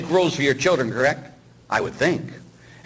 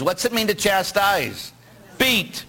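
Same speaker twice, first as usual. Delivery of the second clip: normal talking voice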